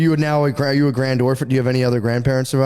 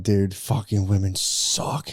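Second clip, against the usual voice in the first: lower, softer, slow grumble; lightly voiced grumpy grandpa; mock old man; 6 semitones lower, 5 dB quieter, much slower than usual. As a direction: drag it out like a dying old man. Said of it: "like, final words of".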